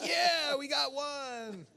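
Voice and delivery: high, loud whooping shout; triumphant cheer. Retold or imitated. Canadian celebrating Wolverine as one of his own, triumphant shout. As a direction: cheer with a long-held last word.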